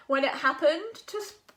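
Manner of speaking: plain reading voice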